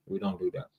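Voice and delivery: usual speaking voice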